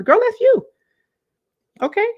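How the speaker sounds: loud, high-pitched insistence; emphatic exclamation. Acted. Coach urging listener not to abandon herself, high emphatic exclamation. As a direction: burst out high and loud to drive it home.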